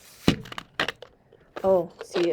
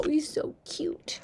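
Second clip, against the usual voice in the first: soft slow whisper; 6 semitones higher, 8 dB quieter, slower than usual. Real, not acted. Whispering over a tiny toy.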